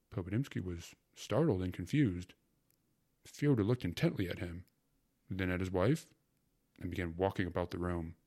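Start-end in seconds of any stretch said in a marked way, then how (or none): none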